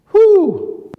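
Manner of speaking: high, sudden exhaled "whew"; overcome whew. Real, not acted. Preacher moved by baring soul to God, high whew. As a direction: let out a high whoop of relief.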